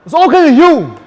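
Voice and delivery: angry. Real, not acted. Blaming friend, angry.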